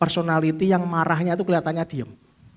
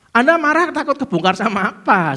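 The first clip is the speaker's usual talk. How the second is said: ordinary delivery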